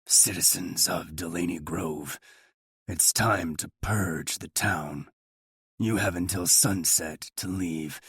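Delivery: low, slow, menacing broadcast voice; a sinister puppet-master announcement; creepy Saw voice. A narrator's reading. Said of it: "speaking like the damn creepy voice from Saw".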